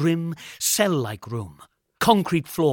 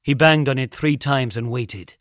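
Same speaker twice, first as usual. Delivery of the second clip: ordinary delivery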